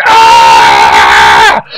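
loud, drawn-out frustrated scream; angry yell. Real, not acted. Gamer venting after his rival dropped on HQ, raging yell. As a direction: let out a long, low roar of frustration. Vents anger at being killed.

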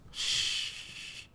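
quick unvoiced shh; shushing. Real, not acted. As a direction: hush sharply to focus. Shushing while concentrating in game.

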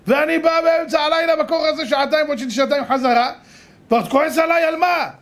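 high-pitched, hammed-up protest; indignant complaint. Messenger protesting to the angry winner, indignant.

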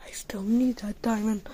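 unvoiced secret whisper. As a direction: whisper to yourself. Whispering to self.

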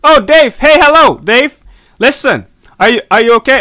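loud, high call after someone leaving. Real, not acted. Player yelling after a fleeing character, loud calling out. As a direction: shout across to Dave to stop him.

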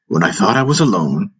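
ordinary delivery, nothing marked

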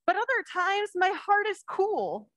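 slow, lifted storybook voice; reading to children. Acted. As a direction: draw the little ones into the story. Reads picture book to children.

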